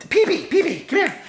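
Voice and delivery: a high, coaxing call to the dog. Retold or imitated. Calling her dog inside.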